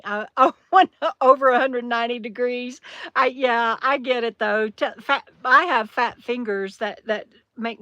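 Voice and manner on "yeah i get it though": usual speaking voice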